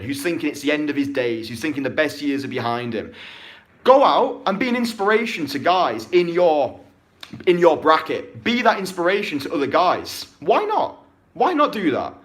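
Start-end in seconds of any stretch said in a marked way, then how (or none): 3.8-12.1 louder, pushing shout; rally them hard; fired-up rant. Real, not acted. Coach urging older guys to inspire others, loud. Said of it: "rant over".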